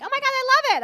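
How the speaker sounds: high, loud mock gushing; gushing fan. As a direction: squeal it like an adoring fan. Voicing an Emily in Paris fan.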